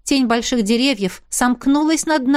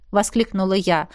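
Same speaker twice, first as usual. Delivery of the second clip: ordinary delivery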